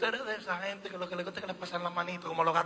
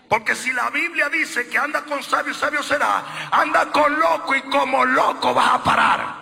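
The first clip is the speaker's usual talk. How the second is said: higher, louder preacher's cry; preaching; 9 semitones higher, 11 dB louder than usual. Acted. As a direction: quote scripture and shout the end. Preaching at full force.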